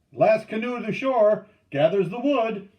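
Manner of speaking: high, loud rallying yell; shout. A narrator's reading. Canoeman starts the race to shore, shouting. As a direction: shout above a crowd of voices. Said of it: "A shout rose above the other voices".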